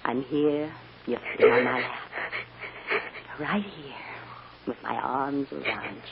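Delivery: low, soft, soothing voice; reassuring. Acted. Mother with arms around child, reassuring softly. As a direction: settle low and tender.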